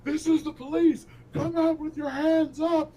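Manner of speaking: very high, loud bellow of a cop through the door; police shout. Acted. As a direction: yell it like a cop ordering everyone out. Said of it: "you hear a voice outside going".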